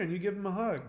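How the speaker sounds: ordinary talk, nothing marked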